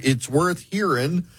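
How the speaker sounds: ordinary talk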